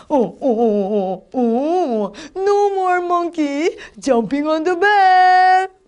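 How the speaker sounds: scolding monkey doctor, drawn out; hammed-up doctor telling off the monkeys; storybook doctor. Shown as a demonstration. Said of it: "이렇게 실감나게 재밌게 해야지".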